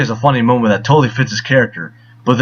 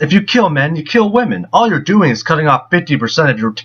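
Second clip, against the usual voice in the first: ordinary talk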